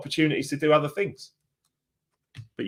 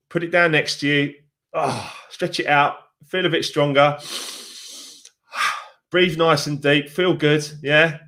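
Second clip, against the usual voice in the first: guided visualization; 3 semitones higher than usual. Acted. Leads a visualization exercise.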